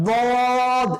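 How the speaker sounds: বদ said as a long heavy "dwod"; thick dad. Shown as a demonstration. Teacher names the second twin letter, heavy বদ. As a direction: thicken the d opening বদ, stretch the vowel. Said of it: "এটাও মোটা করে".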